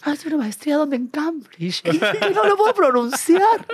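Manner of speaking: put-on high voice for a quote; high-pitched mock voice. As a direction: mimic it in a squeaky high register. Mimics a quote in the anecdote.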